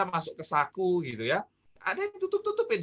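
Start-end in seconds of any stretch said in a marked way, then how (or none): 1.8-2.8 tutup-tutupin jumps far higher, then falls; suspicious high tone. Shown as a demonstration. Suspicious hidden gestures explained. (nada tinggi curiga pada "tutup-tutupin")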